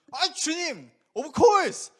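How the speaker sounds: loud, high cocky answer; brash Peter. Pretend. Old Peter answering Jesus, loud and cocky. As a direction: toss it off boastfully.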